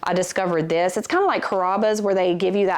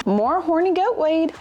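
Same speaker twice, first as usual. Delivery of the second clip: normal talking voice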